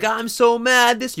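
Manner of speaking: hammed-up road rage outburst; mock fury. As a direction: yell it high like a cheated driver. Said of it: "You're like".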